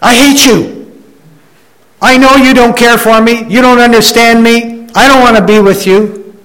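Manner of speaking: mock belligerent child; bratty child. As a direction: throw it like a sulking child yelling at a parent. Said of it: "the child responds by saying".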